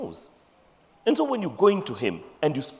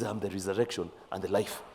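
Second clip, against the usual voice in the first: much softer and lower, calm and sure; quiet solemn; 9 semitones lower, 9 dB quieter than usual. Acted. Jesus to grieving Martha, quietly solemn. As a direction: let it fall low and gentle, full of authority.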